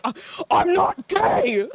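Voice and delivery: high, drawn-out mock protest; hammed-up denial. Streamer imitating her younger self's denial, hammed-up protest. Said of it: "I was so like".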